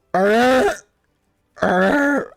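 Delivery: high, loud gag with a blade in the throat; strangled arr around the pommel; knife stuck at his lips, high choking arr; choked gurgle. Acted. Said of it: "He goes".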